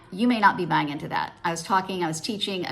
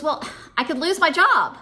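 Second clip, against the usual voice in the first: irritated; 9 semitones higher, 5 dB louder than usual. Retold or imitated. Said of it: "she's getting a little bit irritated with me".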